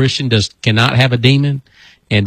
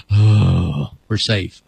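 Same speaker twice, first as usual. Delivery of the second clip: mock relief; 4 semitones lower, much slower than usual. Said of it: "Everybody goes".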